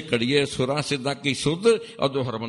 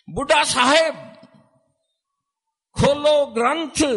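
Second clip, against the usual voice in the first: high, loud summoning call; 8 dB louder than usual. Retold or imitated. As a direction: summon him to open the Granth. Guru's call to Baba Buddha. (ਉੱਚੀ ਆਵਾਜ਼ ਵਿੱਚ ਸੱਦਾ)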